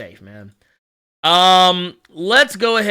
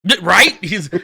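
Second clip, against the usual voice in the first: ordinary talk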